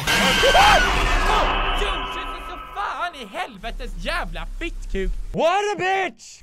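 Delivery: high, drawn-out frightened cursing; scream, then curse in high panic; real startled screaming. Real, not acted. Jump scare, swearing in fright.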